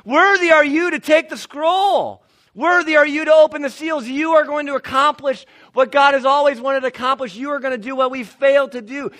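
loud, exultant praise; worship proclamation. Acted. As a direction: declare it like a hymn of praise. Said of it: "what should we sing".

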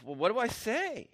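exasperated, stammering false starts; acted flustered indignation; beside himself. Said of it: "Paul's beside himself".

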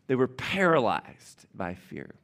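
ordinary talk

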